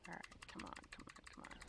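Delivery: hushed impatient urging; psyching myself up. Real, not acted. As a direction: whisper come on under breath. Urging a slow boss spawn.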